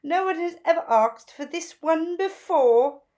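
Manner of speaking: high, loud, gushing fashion bird; gushing Zelda. A narrator's reading. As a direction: gush over the new look like a flamboyant stylist. Zelda voiced in story.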